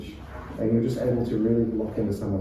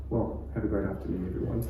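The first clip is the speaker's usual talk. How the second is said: much softer, trailing away; fading apology; 4 semitones lower, 9 dB quieter, faster than usual. Retold or imitated. Preacher mocking a sermon ending that fades out, quiet. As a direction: let it fizzle out apologetically. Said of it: "our voice just".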